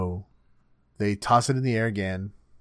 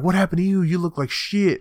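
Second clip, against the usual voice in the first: mock teasing voice; 8 semitones higher, 4 dB louder than usual. Acting out a teasing line.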